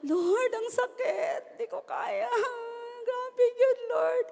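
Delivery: high, soft, crying plea to the Lord; a broken heart crying out in prayer; tearful prayer. Pretend. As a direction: sob it out to God, mock heartbroken.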